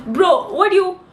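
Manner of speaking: high, loud indignant outburst; exasperated protest. Real, not acted. Pupil in far corner protests to teacher, exasperated. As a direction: burst out, lost for words.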